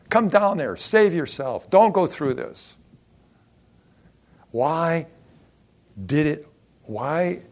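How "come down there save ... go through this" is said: higher, louder pleading jeer; raised mocking plea; crowd crying out. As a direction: call up at him on the cross. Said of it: "crying out to him on the cross".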